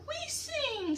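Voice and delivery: very high little bird voice; baby birdies. Acted. Baby robins answer their mother, very high. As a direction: pipe it up like tiny robins.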